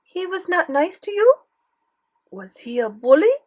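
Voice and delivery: put-on high, rising French-accented questions; probing grandmother with French accent. A narrator's reading. Grandmother asking if boy was bully, French accent. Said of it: "Grandmere had a thick French accent".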